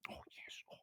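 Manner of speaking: soft whispered "oh, yes" to the cat; cooing whisper. Acted. Lestard whispering to the cat while scratching, hushed. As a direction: breathe it to the purring cat.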